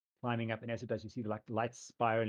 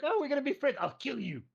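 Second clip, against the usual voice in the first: mock sweet threat; 14 semitones higher, 5 dB louder than usual. GM mimics player's threat.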